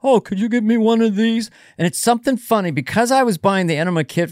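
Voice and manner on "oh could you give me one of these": higher put-on asking voice; imitating himself as a kid. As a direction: ask sheepishly in a put-on voice. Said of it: "This is how we talk".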